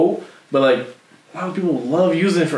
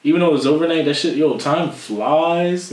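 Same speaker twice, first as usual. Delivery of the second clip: ordinary delivery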